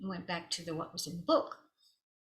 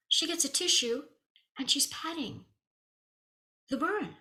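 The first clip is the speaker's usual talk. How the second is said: normal talking voice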